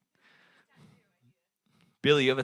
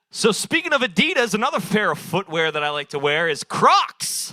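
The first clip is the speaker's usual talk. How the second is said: proud callback; 6 semitones higher, 6 dB louder than usual. Real, not acted. Callback punchline proudly delivered.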